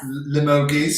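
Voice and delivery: Limoges said as lih-MOH-geez, hard g at the end; brother's mispronunciation. Retold or imitated. Mimicking her brother's Limoges for the live chat, hard g ending. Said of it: "as my brother used to call it".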